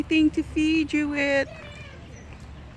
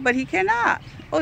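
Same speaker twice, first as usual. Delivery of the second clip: ordinary talk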